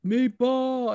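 high, drawn-out cheer of a nickname; excited call-out. Retold or imitated. Friend breaking news of a win, excited high call. Retelling excited text about fighter.

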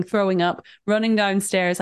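ordinary talk, nothing marked

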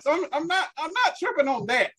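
normal talking voice

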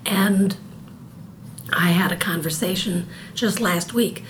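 ordinary talk, nothing marked

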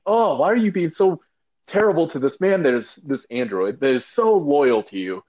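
high, loud exasperated protest; outraged viewer. Retold or imitated. Fan protests at Mother on screen, high exasperated. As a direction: plead in disbelief at the screen. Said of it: "I'm like".

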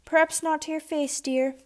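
high, squeaky whine; squeaky spoiled whine; whining. Acted. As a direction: whine it like a squeaky vault door. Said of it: "Frances whined like a squeaky vault door".

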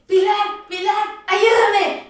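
high, wailing mock plea; imitated plea for help. Retells injured boy pleading for help. (súplica aguda imitada)

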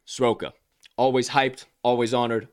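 normal talking voice

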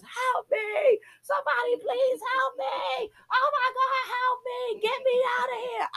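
high, frantic cries for help; frantic yell for help. Retold or imitated. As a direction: scream to be let out, trapped and panicking. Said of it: "I'm yelling to the top of my lungs".

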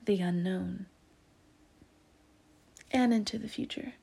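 hushed whisper into the unknown. Acted. Tarot reader whispering a spooky prediction. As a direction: breathe the words like a secret.

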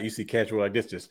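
ordinary delivery

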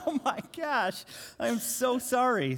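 high, slow sheepish apology; mock apology. Father apologizing to terrified son, high and slow. Retelling his own apology.

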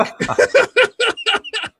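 high-pitched real laugh; helpless high laughter. Real, not acted. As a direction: burst out laughing at your own impression. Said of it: "I laugh about it now".

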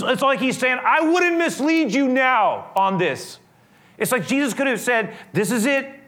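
high, loud full-force sermon voice; fervent preaching. Acted. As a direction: preach it out to the whole congregation.

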